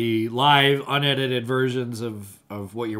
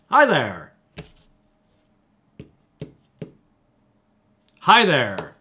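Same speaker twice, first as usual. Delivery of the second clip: surprised greeting; 9 dB louder, slower than usual. Shown as a demonstration. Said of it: "Doesn't work even if you're surprising".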